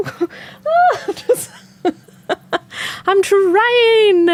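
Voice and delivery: high-pitched laughing whine, half-crying from laughter; laughing protest. Retold or imitated. Host laughing back at viewers saying goodnight, high giggling whine. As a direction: squeak it out through giggles, pretending to be overwhelmed. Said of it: "I'm like".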